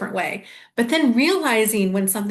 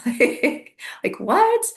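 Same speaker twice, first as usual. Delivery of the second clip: baffled squeak; 7 semitones higher, much slower than usual. Retold or imitated. Retelling her baffled reaction.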